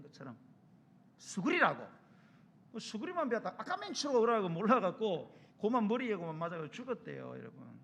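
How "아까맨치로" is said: mock shout. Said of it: "소리를 쳤는데도".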